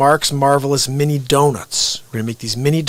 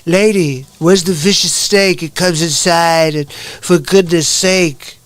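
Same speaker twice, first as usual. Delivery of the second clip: slow, measured presidential verse; Donald Trump; 2 semitones higher, 4 dB louder, slower than usual. Retold or imitated. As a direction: improvise rhymes in a drawn-out impression. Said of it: "And he's like".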